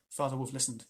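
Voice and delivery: ordinary delivery, nothing marked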